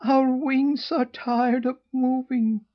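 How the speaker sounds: high, slow, wailing gosling voice. A narrator's reading. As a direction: wail it like exhausted little geese. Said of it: "wailed the young ones".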